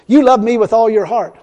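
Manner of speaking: retold voice of God. The Lord speaks to a troubled young believer, retold voice of God. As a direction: lightly voice God speaking out loud, firmly. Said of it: "of a audible voice sounding".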